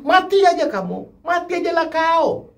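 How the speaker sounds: imitating a Batak-accented wife shouting. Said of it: "Jadi logat Bataknya keluar".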